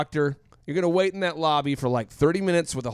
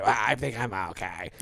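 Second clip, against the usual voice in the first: lower, softer, weak patient voice; sick husband tells his wife he's okay, low and weak; feeble sick husband; 7 semitones lower, 5 dB quieter than usual. Retold or imitated. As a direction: sound brave but feeble. Sick man answering his wife.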